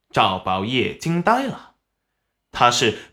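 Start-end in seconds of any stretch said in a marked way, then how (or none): none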